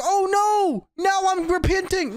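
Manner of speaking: high mock-whiny voice; a hint of a whining villain. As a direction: fake regret, hammed-up. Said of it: "now he's like".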